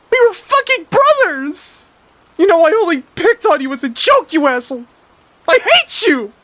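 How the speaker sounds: screamed way up high and very loud; grieving brother screaming at his murderous brother; furious screaming. Acted. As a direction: scream it at the brother who killed them, voice cracking high. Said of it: "Mister Grouper yells".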